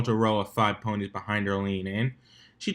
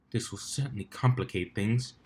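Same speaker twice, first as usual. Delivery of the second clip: thought to herself; 2 semitones lower, 8 dB quieter than usual. Acted. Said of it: "she thought to herself".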